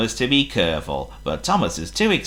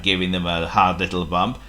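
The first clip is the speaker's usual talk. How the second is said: ordinary delivery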